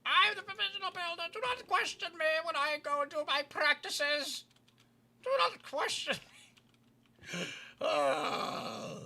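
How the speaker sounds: raspy, hoarse chain-smoker voice; smoker's rasp. Real, not acted. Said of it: "I sound like a chain smoker today".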